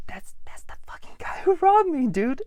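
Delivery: hissed whisper to a coworker, high and tense; urgent whisper. Retold or imitated. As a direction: whisper it so he won't hear, pointing him out. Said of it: "I'm like".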